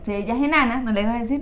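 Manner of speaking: ordinary talk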